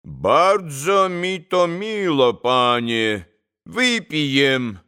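put-on slow Polish-accented reply; a Polish gentleman speaking Polish. Pan on the sofa answering Mitya in a Polish accent.

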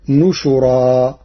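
نشرا with nun and a u on the shin, drawn out slowly; Warsh reading. Shown as a demonstration. Teacher demonstrates Warsh's نشرا slowly with nun and shin. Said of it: "بالنون والشين المضمومتين".

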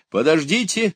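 high urgent shout to wait. A narrator's reading. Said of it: "закричал он". (высокий крик: остановить)

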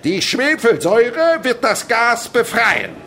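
slow, high, very loud; grand proclamation. Acted. Chemist announcing the reaction to pupils, slow and loud. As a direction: declare it like a prophecy.

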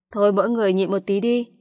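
plain reading voice

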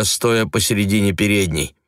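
plain reading voice

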